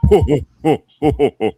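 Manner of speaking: booming ho ho ho called out across the woods; mimicking a loud whoop from the trees; loud hooting call. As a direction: hoot it out loud like a call from the brush. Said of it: "like that".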